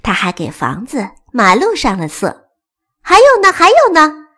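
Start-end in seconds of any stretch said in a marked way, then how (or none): 3.0-4.3 high, loud eager question; thrilled, high-pitched urging; happy and excited. Acted. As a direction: ask it bursting with excitement. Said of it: "铅笔又开心又兴奋地问".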